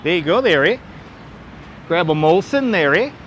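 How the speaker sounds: mock Canadian accent with a tagged-on "eh"; playful Canadian accent. Shout-out to Canadian viewers, in a playful Canadian accent. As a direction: put on a Canadian lilt for fun. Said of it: "all my Canadian viewers there".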